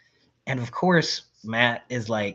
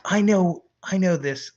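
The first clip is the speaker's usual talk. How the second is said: cocky boast; 7 semitones higher, 5 dB louder, slower than usual. Retold or imitated. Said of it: "He's like".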